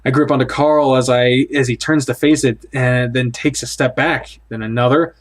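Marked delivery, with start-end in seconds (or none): none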